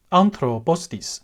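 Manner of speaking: slow "anthropostis" with a second accent on the last vowel; two accents. Shown as a demonstration. Teacher showing a word with two accents, slow and careful. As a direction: stretch "anthropostis" out slowly and accent the ending too. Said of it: "For example".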